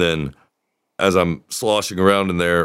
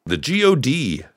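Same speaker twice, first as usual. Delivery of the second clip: car ad voice; 9 semitones higher than usual. Pretend. Mock car advert slogan.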